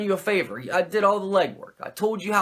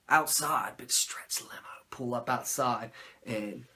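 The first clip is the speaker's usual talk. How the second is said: hushed whisper; 6 semitones lower, 10 dB quieter, slower than usual. Retold or imitated. Telling the audience a limo pulls up, in a hushed whisper. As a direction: whisper the limo's arrival.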